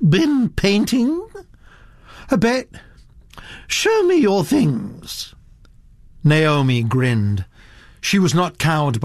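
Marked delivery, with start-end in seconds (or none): 0.1-5.3 put-on higher, imperious old lady gossiping; the Duchess holding forth to Mister Satterthwaite, imperious; haughty old Duchess. Grande dame talking down her cousin's girl, haughty voice.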